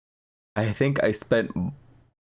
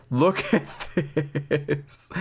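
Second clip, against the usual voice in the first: laughing; 5 semitones higher, much slower than usual. Real, not acted. Laughs at old footage.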